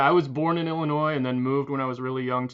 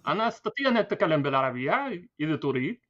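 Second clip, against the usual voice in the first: Arabic; 4 semitones higher than usual. Shown as a demonstration. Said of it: "Can you do a heavy Middle Eastern accent".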